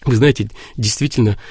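ordinary delivery

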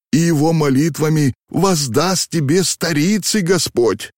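high, wailing entreaty; desperate pleading. A narrator's reading. Old man begs coachman to save the boyar child, imploring. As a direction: beseech the driver in a high, pressing voice.

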